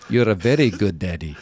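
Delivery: imitated Russian-accented little girl; young girl, Russian accent. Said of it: "it sounded a little Russian".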